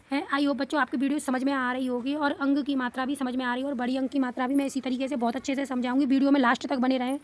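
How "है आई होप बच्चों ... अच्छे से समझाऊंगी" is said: usual speaking voice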